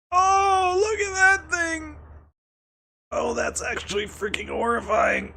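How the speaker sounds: very high, squealed dismay; high-pitched appalled reaction; horrified wail. Real, not acted. Startled by a horrifying picture.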